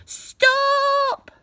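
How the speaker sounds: put-on boy desperate as porridge floods the room; desperate cry. A narrator's reading. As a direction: wail it in despair. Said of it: "cried the boy".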